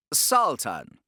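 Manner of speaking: a menacing churchman speaking silkily; Torquemada voice. Acted. Grand Inquisitor addressing the defeated Sultan, smooth and pleasant.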